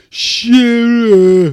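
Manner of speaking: loud, high shouted "Shearer"; mock shout of a name. Mate yelling "Shearer" at the footballer, loud. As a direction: bellow "Shearer" across the bar. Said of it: "I think he was like".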